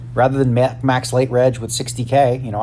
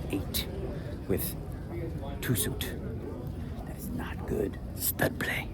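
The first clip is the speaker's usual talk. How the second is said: whisper; 13 dB quieter, slower than usual. Real, not acted. Muttered aside at poker table.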